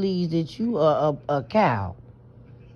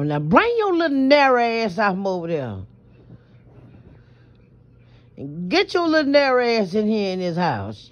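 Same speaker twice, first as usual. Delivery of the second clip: scolding grandma; 5 semitones higher than usual. Retold or imitated. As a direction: call the child home like a fed-up grandma. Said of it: "I'm like my grandma".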